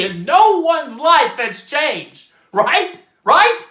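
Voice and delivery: loud, rising exasperation; agitated rant. Acted. Character ranting to the audience about the play's ending, agitated rant. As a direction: build to a high, demanding finish.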